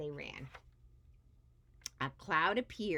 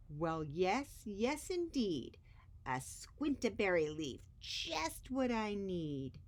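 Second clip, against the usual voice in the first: creepy creature voice; 3 semitones higher than usual. Acted. Monster gloats over his prize, creepy voice. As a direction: savour the find like a greedy beast. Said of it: "scratcher finds the leaf".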